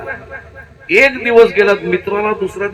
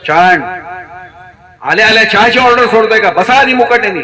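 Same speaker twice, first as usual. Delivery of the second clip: loud, higher, sharp nagging wife; scolding wife; 3 semitones higher, 5 dB louder than usual. Acted. Wife scolds her husband as he comes home, loud and sharp. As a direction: tell him off the moment he walks in.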